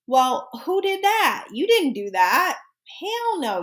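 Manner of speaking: high, drawn-out mock disbelief; hammed-up scandalized reaction; mock outrage. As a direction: exaggerate your indignation, stretching the words high. Acts out sympathizing with troubled friend.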